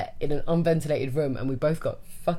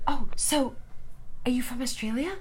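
lightly voiced American girl; 7 semitones higher, 4 dB quieter than usual. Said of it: "she then was like".

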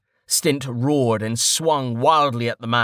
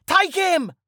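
high-pitched yelled command; screamed order. A narrator's reading. Bandit leader sending men at the archer, screaming. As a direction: yell the attack order, voice way up. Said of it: "the leader screamed".

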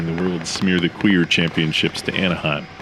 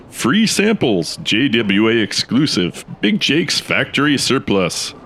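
slow, high sales announcer; slow pitchman read; 5 semitones higher, slower than usual. Acted. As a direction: draw out like a banner slogan.

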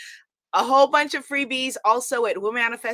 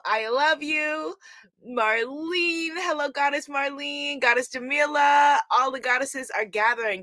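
normal talking voice

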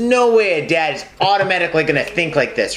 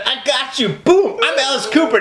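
hyped wrestler shout, pitched up; 7 semitones higher than usual. Retold or imitated. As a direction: play a cocky wrestler. Acting wrestler quarrel.

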